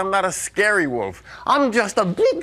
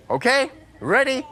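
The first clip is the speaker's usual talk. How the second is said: normal talking voice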